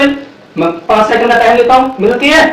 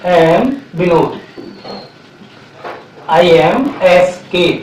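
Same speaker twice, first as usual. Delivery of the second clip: slow, word by word, a little softer; slow model sentence; 4 semitones lower, 4 dB quieter, much slower than usual. Shown as a demonstration. Teacher modelling the right sentence for students, slow and deliberate. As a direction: spell the correct sentence out slowly for the class. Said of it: "simple सा बोलिए".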